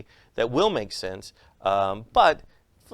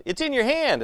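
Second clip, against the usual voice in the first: put-on kid yelling; 14 semitones higher than usual. Kid in the audience calling out to the magician, high shout. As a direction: blurt it like an eager kid.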